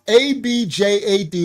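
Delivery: abjad spelled letter by letter. Shown as a demonstration. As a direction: spell out A B J A D one letter at a time. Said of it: "So you have abjad".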